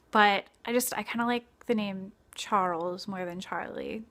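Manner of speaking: ordinary talk, nothing marked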